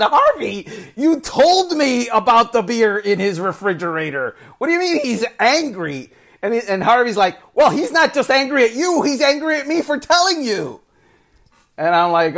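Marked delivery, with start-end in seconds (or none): 0.0-6.1 high, laughing protest of innocence; incredulous and amused, pitched up; laughing disbelief. Retold or imitated. Disbelieving protest retold to the listener. 7.5-10.8 high, fast, worried explaining; hurried, high retelling of a nervous aide; flustered media director. Retold or imitated. Media director's panicked reply, retold.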